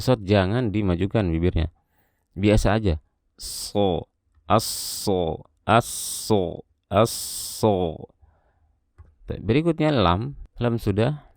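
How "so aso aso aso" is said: SO with a long, sharp hissing s, no whistle; sharp hissed sod. Shown as a demonstration. Tajwid teacher demonstrating sofir on sod, sharp hissing s. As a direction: draw out the s of SO as a thick, piercing hiss. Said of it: "suara tajam, bukan siulan ya".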